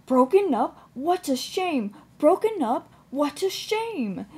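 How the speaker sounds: high, chanted outcry of dismay; dismayed exclamation. A narrator's reading. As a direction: exclaim it high, in a repeating pattern like a chugging engine. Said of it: "claimed Edward as he went back to work".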